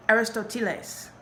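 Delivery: put-on Aristoteles in careful Spanish pronunciation with a Mexican accent; name pronounced in formal Mexican Spanish. A narrator's reading. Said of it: "this really formal Mexican accent".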